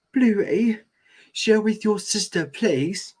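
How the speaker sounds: gentle mom voice. Acted. Chili to Bluey.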